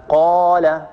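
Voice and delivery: قال said slowly with a drawn-out alif; lengthened vowel. Shown as a demonstration. Teacher showing a madd letter to students, lengthened vowel. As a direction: stretch the long aa in قال. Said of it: "وقابلية التطويل وقابلية الزيادة".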